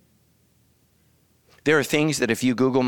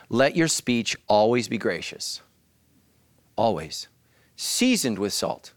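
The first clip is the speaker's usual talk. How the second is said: scripture reading; slower than usual. Acted.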